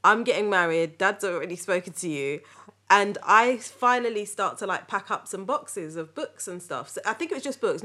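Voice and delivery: ordinary talk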